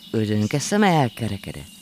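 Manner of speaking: ordinary delivery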